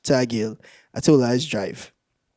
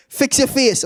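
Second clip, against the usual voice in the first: higher-pitched scolding command; mock stern mother; 8 semitones higher than usual. Mother scolding her crying son, mock stern. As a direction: play a mother telling off her child.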